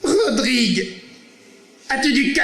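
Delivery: hammed-up grand tragedian, high and pompous; lofty classic line, mocked; mocking old actor. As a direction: ape a stale Comédie-Française actor. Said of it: "Comme il vous dirait".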